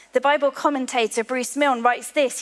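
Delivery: ordinary delivery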